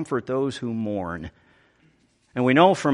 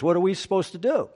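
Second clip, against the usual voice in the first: usual speaking voice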